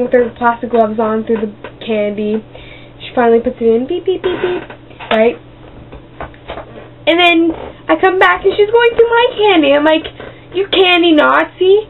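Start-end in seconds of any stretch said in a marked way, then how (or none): 3.9-4.7 high soft beep beep beep like a till; scanner beeps. Retold or imitated. Scanner noise imitated. 10.5-11.9 mock insult. Insulting the cashier.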